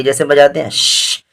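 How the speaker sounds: ordinary delivery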